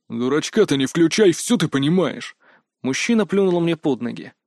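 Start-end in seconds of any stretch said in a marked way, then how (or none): 0.1-2.4 angry guard. A narrator's reading. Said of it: "собеседник был реально зол".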